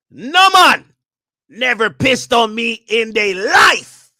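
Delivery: loud, high Jamaican patois shout, slow at the end; Jamaican accent. Retold or imitated. [name]'s comment read aloud in a loud Jamaican accent. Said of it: "Oh, he got a Jamaican accent".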